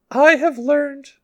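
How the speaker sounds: put-on high, slow, solemn little-kid voice; a small child intoning like a priest; priestly young child. Blind cult child addresses the village, priestly child voice. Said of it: "the voice is of a young child, he speaks very maturely, almost like a priest".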